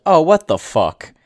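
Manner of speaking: loud, slow outraged yell. Retold or imitated. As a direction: shout it in disbelief. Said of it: "the first time that I yelled".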